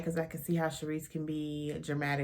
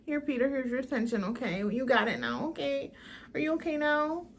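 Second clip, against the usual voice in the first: put-on higher, fast sarcastic baby-talk to Peter; mocking sarcasm; 6 semitones higher than usual. Mocking the cast's behavior to Peter.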